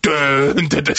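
dun dun dun, sung like a horror score; mock horror sting. Mimicking the film's horror music sting. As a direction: hum the creepy music cue. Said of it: "The music's like".

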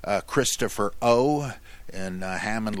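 usual speaking voice

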